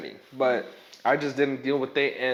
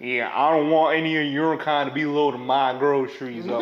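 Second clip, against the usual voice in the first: put-on country accent; 2 semitones lower than usual. Retelling a rude customer's refusal in a mock country accent. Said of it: "he had that country accent".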